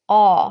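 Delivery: "aw" said loudly, open and round; demonstrating the "aw" vowel; open "aw" sound. Shown as a demonstration. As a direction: sound out a full "aw". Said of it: "little more intuitive".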